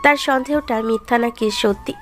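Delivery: ordinary delivery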